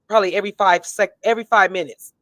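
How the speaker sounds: normal talking voice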